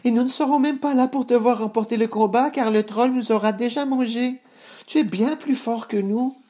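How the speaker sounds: very high-pitched goat voice; small goats protesting, very high; frightened goat brothers. Acted. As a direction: plead high as scared little goats.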